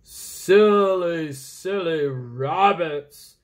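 loud, slow teasing owl voice; owl scolding. Acted. Owl mocking the sleepy rabbit, slow and loud. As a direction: tease him like a big old owl. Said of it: "said the owl".

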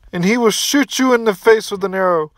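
usual speaking voice

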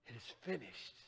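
very soft, low final breath; dying words. Acted. Jesus on the cross speaking his last to his Father, very soft. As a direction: let it out barely audible.

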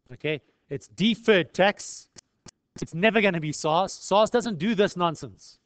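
ordinary talk, nothing marked

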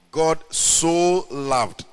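slow, drawn-out "so" in "God so loved"; "so" stretched and weighted in "God so loved"; stress on so. Shown as a demonstration. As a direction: lean hard and long on "so". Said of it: "The emphasis on the word is so loved".

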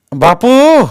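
loud, high wail of grief; father's anguished cry. A narrator's reading. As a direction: cry out the son's name in anguish. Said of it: "बाबांच्या ओरडण्याचा आवाज". (दुःखाने मोठ्याने हाक)

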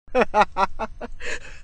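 staccato laugh in a long run of bursts; high, drawn-out staged laughter; character laugh. Acted. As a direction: laugh it out in separate, evenly spaced beats.

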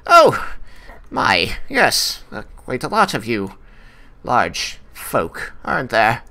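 fussy gnome voice. Acted. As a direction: peer up at the big folk, fussily. Gnome notices the party.

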